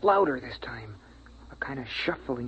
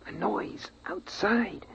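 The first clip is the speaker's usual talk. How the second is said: hushed dread; 5 semitones higher, 6 dB quieter, much slower than usual. Acted. Boy hears noise in the dark.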